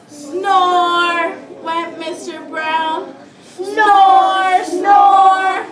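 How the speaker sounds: slow, stretched, sing-song storybook reading; snoring in read-aloud. Acted. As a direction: draw out each snore loudly for the children.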